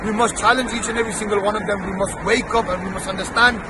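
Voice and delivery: formal protest speech to supporters; rally speech. Real, not acted. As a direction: rouse the listeners like a street orator. Addresses crowd with a declaration.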